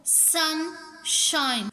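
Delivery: "sunshine" as two separate drawn-out beats; split into syllables. Shown as a demonstration. Teacher showing syllabication, "sunshine" said in two parts. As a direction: break "sunshine" after sun. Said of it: "इनमें भी सिलेबिकेशन होती है".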